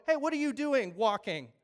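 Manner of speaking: loud, indignant challenge from the religious men; mock accusing. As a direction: call him out for walking on the Sabbath. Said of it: "these religious guys look at him and go".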